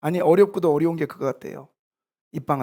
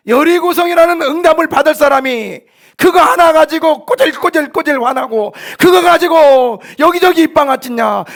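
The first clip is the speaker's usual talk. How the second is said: high, shouted pulpit rebuke; fiery rebuking sermon; 11 semitones higher, 10 dB louder than usual. Real, not acted. Pastor rebuking believers for gossiping, fiery preaching. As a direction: shout it at them in exasperated reproach.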